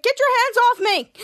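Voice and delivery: acted outburst of annoyance; indignant. Hero in a bag scolding his sidekick, indignant.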